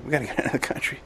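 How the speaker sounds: ordinary delivery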